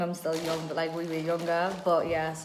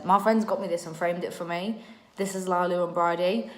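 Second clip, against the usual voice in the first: ordinary talk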